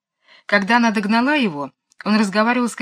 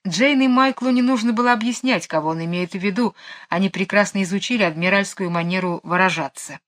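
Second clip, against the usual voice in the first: ordinary delivery, nothing marked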